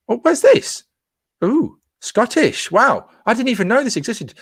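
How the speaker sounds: thrilled by an unknown park run; excited surprise. Real, not acted. Discovers a new Scottish event.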